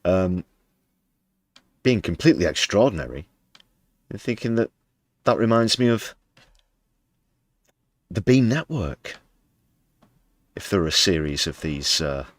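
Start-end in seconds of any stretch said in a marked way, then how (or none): 1.8-9.2 sarcastic. Acted. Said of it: "This is all sarcasm".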